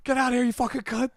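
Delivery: high, fast mock yell; imitated scream. Imitating a comic throwing out audience members, mock scream. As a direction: rattle off a high-pitched furious imitation. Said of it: "He would scream at them".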